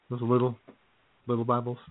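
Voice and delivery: ordinary talk, nothing marked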